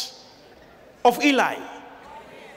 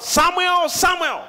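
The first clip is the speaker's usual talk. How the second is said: calling out; 8 semitones higher, slower than usual. Retold or imitated. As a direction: call the boy from afar. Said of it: "and God calls out".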